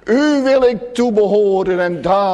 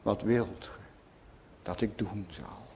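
much lower and softer, gentle asking; hushed tender question; 10 semitones lower, 17 dB quieter than usual. Retold or imitated. Preacher voicing the Lord's question to Bartimaeus, hushed and gentle. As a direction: ask it as if bending close to a blind man. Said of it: "Als de Heer het vanmorgen vraagt".

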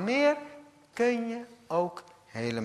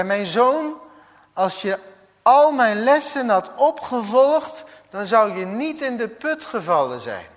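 higher, louder lecturing sage; wise teacher Confucius; 8 semitones higher, 8 dB louder than usual. Acted. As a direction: scold him like a wise old teacher. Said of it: "En toen kwam Confucius langs, een wijs Chinees leraar".

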